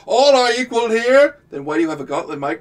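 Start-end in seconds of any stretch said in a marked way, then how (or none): 0.1-1.4 slow, loud, grand proclamation; pompous king voice. Retold or imitated. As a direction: proclaim it like a round-table king. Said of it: "It's like".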